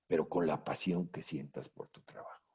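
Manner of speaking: soft whisper, almost breathless. Real, not acted. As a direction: lean in and whisper it like a secret. Énfasis íntimo en la pasión. (susurrando la pasión, casi en secreto)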